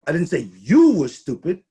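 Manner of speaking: emphasis on you; stress on you. Shown as a demonstration. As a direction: lean on you as if someone else was meant. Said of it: "So I'm gonna do it this way".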